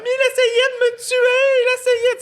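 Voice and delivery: screeched hysterical accusation; mock terrified scream. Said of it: "elle crie". (cri hystérique joué, très aigu et lent)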